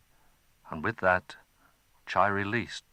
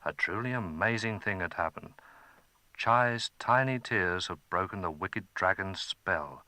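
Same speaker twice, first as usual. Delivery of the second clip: ordinary delivery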